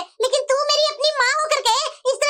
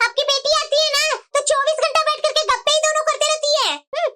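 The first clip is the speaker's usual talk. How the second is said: ordinary talk, nothing marked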